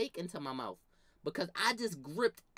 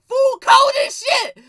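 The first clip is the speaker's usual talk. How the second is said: high laughing shout; 17 dB louder, slower than usual. Retold or imitated. Gloating about the trick, a high laughing yell.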